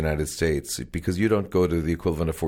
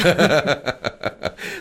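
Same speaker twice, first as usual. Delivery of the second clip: hearty laugh; 7 semitones higher, 3 dB louder than usual. Real, not acted. Interviewer laughs at joke.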